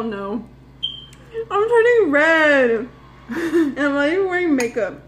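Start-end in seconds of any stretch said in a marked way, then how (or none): 1.5-5.0 whiny cry. Real, not acted. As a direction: cry it out from the burn. Spice overwhelming, crying out.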